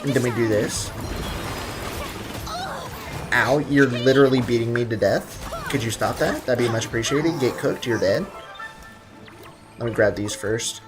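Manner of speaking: ordinary talk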